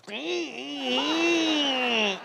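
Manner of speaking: slow, drawn-out mock hum; a silly slow hum in her ear. Husband joking in wife's ear, slow hum. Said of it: "as a joke now, in her ear".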